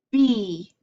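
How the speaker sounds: loud popped B; B sound demo. Shown as a demonstration. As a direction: burst the B hard for the class. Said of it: "Do you hear that?".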